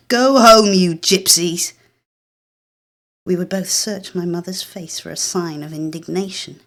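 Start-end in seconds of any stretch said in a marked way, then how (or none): 0.1-1.7 furious shout from a window, much louder; angry hostile yell, slowing on the end. Acted. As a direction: yell it at the neighbour down the street. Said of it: "tear it open and shout".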